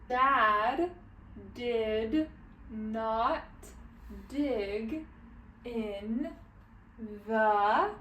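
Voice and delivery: one careful word at a time; isolated words with long gaps between; sounding out words. Shown as a demonstration. Teacher leads the next line, sounding it out word by word. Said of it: "sounding out every word".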